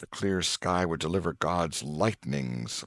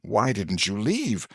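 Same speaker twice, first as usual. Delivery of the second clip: bystander asking; 6 semitones higher than usual. Acted. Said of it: "somebody said".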